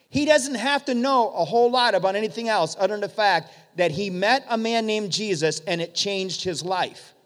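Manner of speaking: normal talking voice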